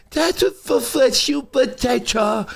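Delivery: put-on high, slow little girl voice. Dad imitating his six-year-old singing in the car, high. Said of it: "With a girl voice, like Lil Pebbles".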